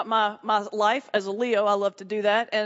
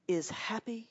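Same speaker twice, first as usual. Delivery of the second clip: ominous hush; 10 dB quieter, slower than usual. Acted. Comic telling Baptists about Puritan fear, in a hushed spooky murmur.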